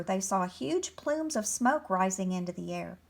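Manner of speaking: ordinary delivery, nothing marked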